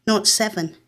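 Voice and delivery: ordinary delivery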